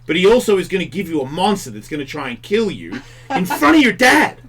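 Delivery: ordinary talk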